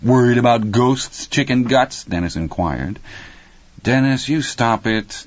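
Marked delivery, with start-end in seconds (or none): 0.0-2.0 put-on higher-pitched teasing kid; mocking boy's jeer; brotherly taunt. A narrator's reading. Older brother taunts Petey. 3.8-5.2 higher, slow motherly reproof; drawn-out, distracted telling-off; absent scolding. A narrator's reading. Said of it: "Terry said absently".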